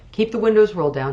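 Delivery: "Keep the windows rolled down" fast, high and loud; urgent order. A narrator's reading. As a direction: bark the order out quickly. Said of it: "repeated take".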